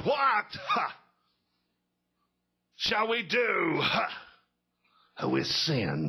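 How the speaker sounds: high "what" then slow preacher drawl with huffed breaths; old revival preacher, punctuating with "ha"; preacher voice number one. Acted. Guest preacher opening a revival sermon, mock preacher voice. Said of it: "he got in preacher voice number one".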